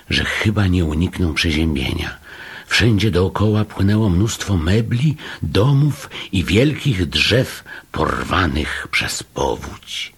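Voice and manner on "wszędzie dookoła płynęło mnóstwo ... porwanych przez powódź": plain reading voice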